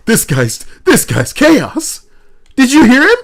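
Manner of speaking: high, loud, delighted exclaiming; excited. Real, not acted. Streamer exclaims to co-host about the chaotic donor, high and loud. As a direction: hype up the chaos.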